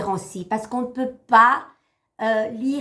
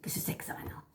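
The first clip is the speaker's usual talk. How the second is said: whispered, the voice almost gone; voice giving out; 16 dB quieter, much faster than usual. Real, not acted. Reader losing her voice on the live stream, whispering. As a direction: push the words out with no voice left. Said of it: "J'en perds ma voix".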